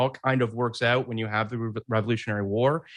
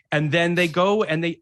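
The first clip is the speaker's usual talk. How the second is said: ordinary delivery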